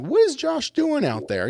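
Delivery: a high, drawn-out bewildered question; puzzled high wonder. Retold or imitated. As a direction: sound baffled at the man outside. Wondering aloud at pacing customer.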